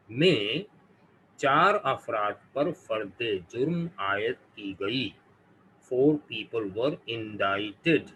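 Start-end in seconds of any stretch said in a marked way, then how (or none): 5.9-8.1 indicted said in-DITE-ed, very slowly; Four people were indicted, with indicted as in-DITE-ed; slow correct indicted. Shown as a demonstration. Teacher reads the example slowly for students. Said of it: "not indicted".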